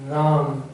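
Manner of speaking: राम with a long held आ; stretched राम; prolonged vowel. Shown as a demonstration. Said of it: "अधिक समय लगता है उसमें".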